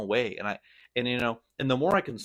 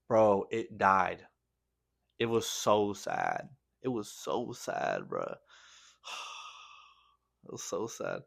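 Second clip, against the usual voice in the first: real sadness; 3 dB quieter, slower than usual. Real, not acted. Sad about the dog that died.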